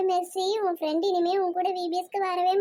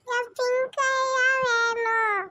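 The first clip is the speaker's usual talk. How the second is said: high, drawn-out tearful wail; little child crying in a higher voice; 4 semitones higher, much slower than usual. Acted. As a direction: wail slowly for what you want. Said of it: "Gloria அழுகுறா பாத்தீங்களா?".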